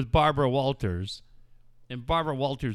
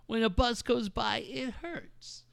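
high, whiny mock victim, fading on hurts; mocking whiny complaint; 7 semitones higher, 5 dB quieter than usual. Mocking Stossel's deafness whining to Barbara Walters. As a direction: whimper it like a wounded crybaby.

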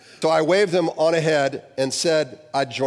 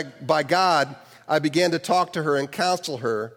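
ordinary delivery

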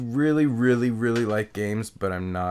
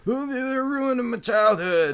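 much higher, slow mocking whine; slower than usual. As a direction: sneer at the whiny fans. Mocking upset fans.

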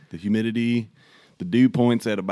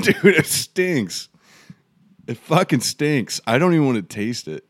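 disgusted; 6 semitones higher, 6 dB louder than usual. Real, not acted. Disgusted by the beer's smell.

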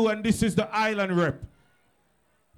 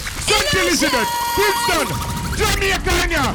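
raised, punchy MC shouting; hyped crowd call; 4 semitones higher, 4 dB louder than usual. Real, not acted. MC roll-calls Jamaican towns to the crowd, hyped shouting. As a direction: fire up the crowd town by town.

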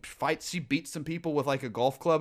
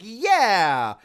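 loud, higher-pitched "yeah"; out-loud agreement; 5 semitones higher, 6 dB louder than usual. Retold or imitated. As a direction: agree aloud with hammed-up conviction. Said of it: "out loud, I'm like".

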